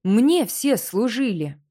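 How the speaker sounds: slow, loud, towering rage; furious roar. Acted. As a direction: draw it out like a giant rising. Koschei rises, darkness spreads.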